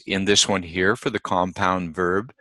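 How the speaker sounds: normal talking voice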